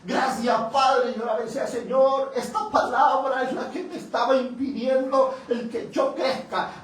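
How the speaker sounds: loud, high cry of thanks to God; a man suddenly seeing the truth, crying out loud thanks; overwhelmed loud thanks. Retold or imitated.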